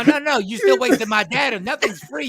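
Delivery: ordinary delivery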